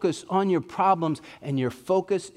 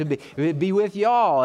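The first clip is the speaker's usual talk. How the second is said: louder, folksy Texan be with y'all; Southern y'all; 4 semitones higher, 3 dB louder, slower than usual. Pretend. Preacher joking Paul spoke like a Southerner, East Texas drawl. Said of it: "he used y'all a lot".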